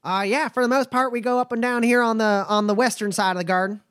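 a wary but friendly trail boss sizing folks up; nomad Bumper. Acted. Bumper answers Roy.

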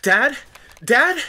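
high, loud scream of shocked recognition; horrified high-pitched cry. A narrator's reading. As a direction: scream out for your father in disbelief. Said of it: "screaming again".

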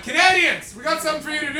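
mock officer calling his men over; calling out orders. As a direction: summon them in a loud, high call. British command hailing Canadian troops.

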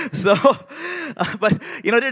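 ordinary delivery

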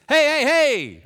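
loud, high barked call to get attention; retold officer's shout over gunfire; commanding shout. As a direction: shout them down like an officer under fire. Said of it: "and he goes".

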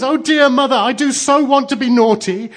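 put-on high, loud childish wail; hammed-up tearful pleading; sobbing child. A narrator's reading. Blue Eyes begging mother to let her be naughty, sobbing. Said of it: "sobbed Blue Eyes".

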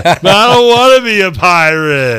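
high, slow whiny pleading; sweet pleading, drawn out and high; Jerry puffy shirt voice. Retold or imitated. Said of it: "I hear it in the Jerry Puffy shirt voice".

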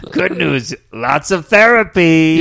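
smooth crook drawing it out; swindler voice. Retold or imitated. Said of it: "running joke".

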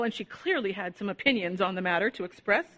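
plain reading voice

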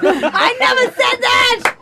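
put-on high, slow, laughing Boston accent; mocking Boston accent. Mocking a Boston tough guy in a thick Boston accent. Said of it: "that is the worst accent on the planet".

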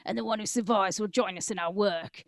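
put-on "and the one who survives" redone in a Cockney gangster accent. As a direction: switch to a London Cockney thug on "survives". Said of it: "this is actually be good in Cockney".

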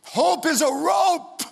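high, slow climax line; preaching shout. Acted. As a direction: ring out the motto. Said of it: "sermon climax".

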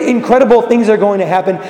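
normal talking voice

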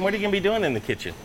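ordinary delivery

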